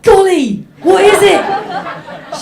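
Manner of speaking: put-on high, loud, slow childlike worried voice; little girl. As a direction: play your seven-year-old self, alarmed. Voicing her seven-year-old self.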